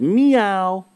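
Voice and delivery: high little kitten meow; a high, light meow for the kitten. Acted. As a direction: mew it like a small cat. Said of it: "And it said".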